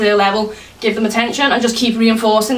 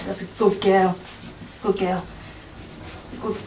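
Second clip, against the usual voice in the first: soft praise; 3 semitones lower, 10 dB quieter than usual. Real, not acted. Praising dog after it sits.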